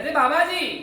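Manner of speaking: high, loud call up the hill. Retold or imitated. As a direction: call him back down from the top. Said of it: "કબીરજીએ ફરી વાર બૂમ પાડી". (કબીરજી ફરી બાબાજીને મોટેથી બૂમ પાડે છે)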